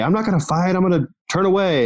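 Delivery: high, whiny voice of a soldier backing out; hammed-up reluctant soldier; mock reluctant soldier.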